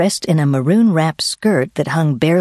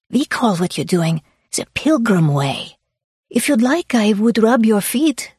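put-on high, Swiss-accented offer of kindness; eager Swiss woman; 7 semitones higher than usual. Swiss woman offering a hiker a foot rub, Swiss accent.

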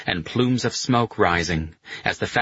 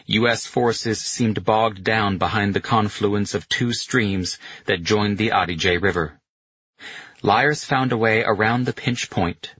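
ordinary delivery, nothing marked

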